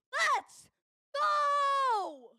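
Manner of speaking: high, loud cheer; victory shout. Real, not acted. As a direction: scream it out in triumph. Celebrating the goal.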